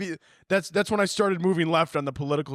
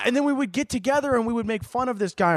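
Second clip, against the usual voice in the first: 1 excited; 7 semitones higher than usual. Acted. Keeps shout energy.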